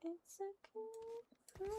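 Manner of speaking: very high, slow silly voice; wild unhinged ranting. Pretend. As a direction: stretch it out in a squeaky voice. Put-on silly voice.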